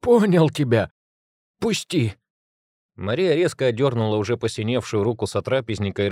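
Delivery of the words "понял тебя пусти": high pleading cry of pain; hit hard crying out. Acted. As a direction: beg to be let go. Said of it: "прохрипел он".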